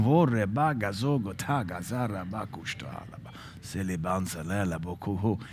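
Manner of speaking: hushed, murmured tongues; soft praying in tongues. Shown as a demonstration. Preacher demonstrating tongues to the congregation, hushed murmur. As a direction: pray in tongues under your breath, lost in it. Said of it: "as soon as you get knocked down".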